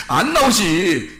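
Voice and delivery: ordinary talk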